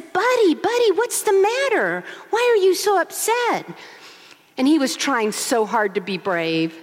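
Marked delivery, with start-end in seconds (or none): 0.0-3.6 higher, gentle fatherly concern; dad checking on his upset boy; husband comforting son. Retold or imitated. Dad asks his tearful son what's wrong, in higher concerned voice. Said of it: "Al looks at him and says".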